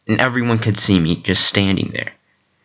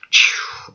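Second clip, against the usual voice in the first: whispered phew. Retold or imitated. Kane after burning goons.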